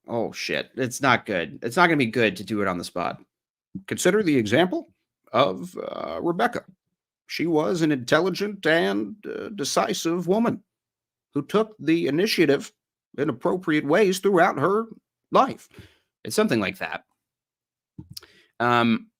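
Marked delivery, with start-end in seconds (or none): 3.9-15.7 halting, higher-pitched presidential impression; measured politician with pauses mid-phrase; Obama. Streamer trying out an old impression on the spot, halting impression. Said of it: "I used to have a decent Obama".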